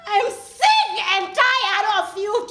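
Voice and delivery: loud, slow, high furious cry; furious outburst. Acted. Repeating it to Ethan to be heard, furious. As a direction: drive it home at full volume. Acted quarrel, louder repeat.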